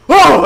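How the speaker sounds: loud, high shocked "oh"; sudden high shout of shock; startled yell. Retold or imitated. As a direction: exclaim in alarm at full voice. Passerby startled by the scene.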